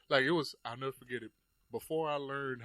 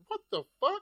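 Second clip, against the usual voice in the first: much higher startled cry; shocked outburst; 5 dB louder than usual. Retold or imitated. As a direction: react like a punch just rocked you. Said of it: "It was one of those".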